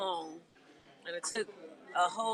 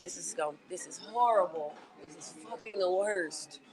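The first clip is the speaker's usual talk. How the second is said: dejected. Retold or imitated. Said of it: "this is my energy right now".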